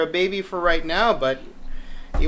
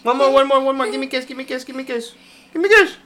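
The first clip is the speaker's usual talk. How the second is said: high, playful coaxing for kisses; 10 semitones higher, faster than usual. Real, not acted. Asking toddler for kisses.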